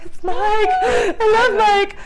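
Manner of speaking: high, drawn-out teary swoon over a star; mock fangirl whimper. Starstruck at seeing Mike, mock teary fangirl squeal. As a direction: whine it like a starstruck fan about to cry. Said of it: "I was like".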